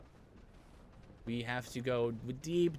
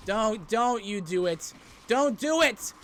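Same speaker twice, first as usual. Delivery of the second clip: high, loud panicked shout; desperate yell; 10 semitones higher, 10 dB louder, slower than usual. Real, not acted. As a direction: beg them not to. Pleading with enemy.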